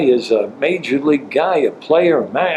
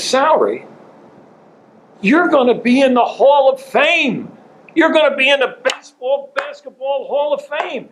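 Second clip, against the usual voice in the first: grand incredulous; 13 semitones higher than usual. Retold or imitated. Owner brushes off salary question.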